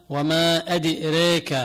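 أدريك with the long a leaning to e, slow; imala. Shown as a demonstration. Teacher recites Warsh's reading with major imala. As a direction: say أدريك as adirek, slowly. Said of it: "هذه يقال لها إمالة".

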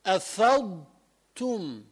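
أفضتم slow, with the ض kept distinct before the ت; clear dad before ta. Shown as a demonstration. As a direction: ease through أفضتم and keep the ض. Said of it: "الضاد اللي بعدها التاء". (ضاد واضحة قبل التاء في أفضتم)

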